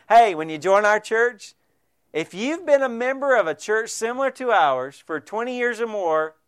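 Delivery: ordinary talk, nothing marked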